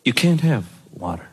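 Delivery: low, firm dad voice; stern dad refusal. Retold or imitated. Dad refusing his daughter a drink, low and firm. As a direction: lay down the law in a low voice.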